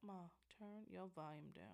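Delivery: very soft, hushed plea; retold discreet request. Asking loud mother to lower volume.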